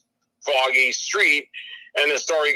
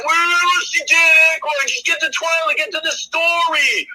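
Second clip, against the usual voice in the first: high, loud mock-whiny yelling; hammed-up nagging outburst; whining; 11 semitones higher, 5 dB louder than usual. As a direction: wail like an impatient viewer. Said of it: "whining about that".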